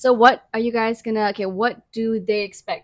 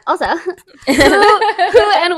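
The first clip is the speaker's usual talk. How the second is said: laughing; 9 semitones higher, 9 dB louder, much slower than usual. Real, not acted. Laughing while introducing.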